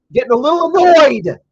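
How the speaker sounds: loud, high exasperated complaint; fed-up shout about the team; annoyed outburst. Real, not acted. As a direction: let the irritation boil over. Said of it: "I'm getting annoyed".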